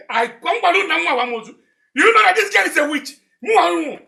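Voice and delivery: loud, high-pitched outrage; angry shouting. Acted. Father accusing the girl of being a witch, angry shouting.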